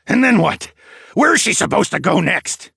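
loud, high-pitched demand; angry challenge. A narrator's reading. Grandfather challenging strangers' rescue offer, angry demand. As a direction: throw the questions back forcefully.

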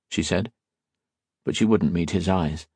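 ordinary delivery, nothing marked

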